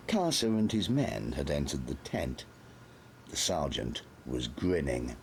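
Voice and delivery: ordinary delivery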